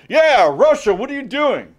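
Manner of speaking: high, sing-song fake surprise; mock innocent taunt. Austria-Hungary pretending surprise at Russia's move, high teasing taunt. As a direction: play dumb and twist the knife.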